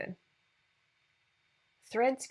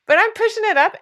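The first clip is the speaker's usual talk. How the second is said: emphatic push; 12 semitones higher, 10 dB louder than usual. Real, not acted. Emotional business pep talk.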